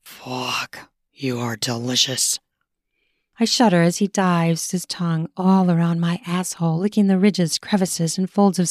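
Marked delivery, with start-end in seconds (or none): 0.0-2.4 low, much softer rasp; raspy male lover. Acted. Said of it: "in a raspy voice".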